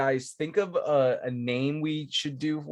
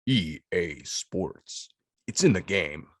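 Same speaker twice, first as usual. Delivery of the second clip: low, slow sports-game tagline; deep announcer voice; 8 semitones lower, slower than usual. Shown as a demonstration. Podcaster doing a game slogan on request, deep voice. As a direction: drop deep and draw out the slogan. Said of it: "you gotta get the deep voice going".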